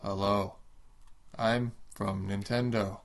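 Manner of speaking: hint of a dull robot presenter. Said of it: "I'm a robot".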